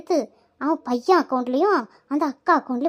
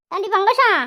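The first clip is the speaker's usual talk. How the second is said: raised, insistent call; calling out loudly; 4 semitones higher, 3 dB louder than usual. Acted. Visitor calls Pangajam out of her house, calling loudly. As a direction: shout for a friend to come out. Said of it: "எங்கிட்ட நின்னுட்டு கத்துறா".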